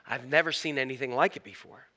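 low, softened musing; quietly sizing up something unfamiliar; mildly cautious lion. Acted. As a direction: voice a wary lion, lightly acted. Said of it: "says with caution".

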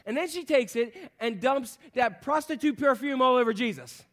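ordinary talk, nothing marked

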